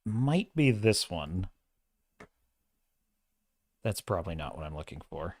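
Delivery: low, soft, absent-minded murmur; trailing mutter while busy searching; muttering to self. Real, not acted. Streamer hunting for a bookmarked tweet, muttering to himself.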